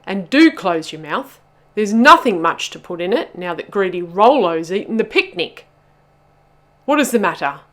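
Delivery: loud, bossy telling-off; scolding. A narrator's reading. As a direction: lecture them loudly and impatiently. Bubba keeps scolding Pog.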